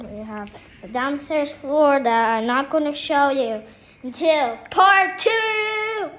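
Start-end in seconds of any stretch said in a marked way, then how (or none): 4.0-6.1 dramatic slow teaser. Acted. Teasing the next episode.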